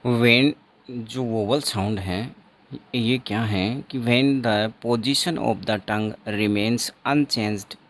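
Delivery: dictating a definition to the class, very slow; slow dictation. Shown as a demonstration. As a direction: read it out slowly and loudly, word by word.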